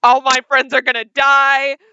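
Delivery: loud, alarmed inner monologue; inner panic. Acted. Player voices Kira's secret certainty of poisoned food, alarmed and loud. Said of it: "in her heart of hearts".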